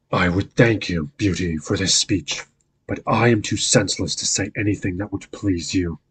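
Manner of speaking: ordinary delivery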